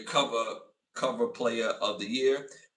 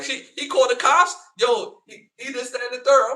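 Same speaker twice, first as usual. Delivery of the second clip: high, louder mock gossip voice; imitating the friend; 11 semitones higher, 8 dB louder than usual. Woman on phone complaining about him, mock high voice. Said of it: "She was like".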